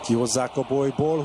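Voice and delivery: ordinary talk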